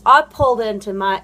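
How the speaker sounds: ordinary talk, nothing marked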